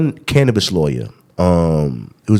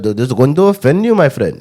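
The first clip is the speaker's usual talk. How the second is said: raised, louder mock Indian accent; singsong Indian accent, pitched up; 5 semitones higher, 3 dB louder than usual. Shown as a demonstration. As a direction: show off a playful Indian accent. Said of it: "I can do a pretty good Indian accent".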